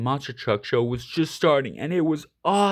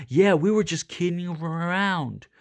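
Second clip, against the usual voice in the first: 1 nervous dog; 5 semitones higher than usual. A narrator's reading. Dog backing down.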